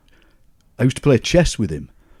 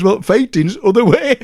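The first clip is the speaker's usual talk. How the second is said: much higher, louder Sheffield uncle; Uncle Jack voice; 10 semitones higher, 7 dB louder than usual. Retold or imitated. Uncle offering the trick jam tart, high Sheffield voice. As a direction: ham up the joking uncle. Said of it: "He says".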